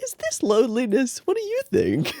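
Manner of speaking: high, casual musing; lightly voiced clueless guy. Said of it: "he just casually is like".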